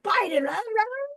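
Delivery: high, rising mock chatter; mocking other side. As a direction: parody the other side's dismissive babble. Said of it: "everybody on this side was like".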